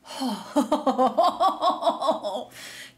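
a bright, giddy burst of laughter; delighted laugh. Real, not acted. Knitter laughing at her yarn obsession, giddy laugh. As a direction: laugh at your own excitement.

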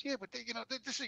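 soft, steady, reasonable voice; quiet it down to calm him; calm brother. Retold or imitated. The brother calming Jimmy, a soft reasonable voice. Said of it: "he goes".

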